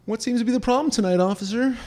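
high teen acting calm for the officer; fake-innocent suave. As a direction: play it cool with the cop. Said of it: "that's how I feel I sound".

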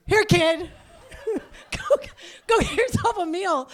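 high, loud mock offer through laughter; mock charity. Stranger handing the baby cash, laughing loudly. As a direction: play the kind stranger while cracking up. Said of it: "Who gives a homeless one-year-old".

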